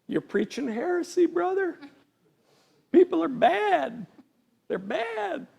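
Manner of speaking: high-pitched mock religious objector; hammed-up protest from a doctrinaire believer; scolding inner critic. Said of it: "part of me that's like".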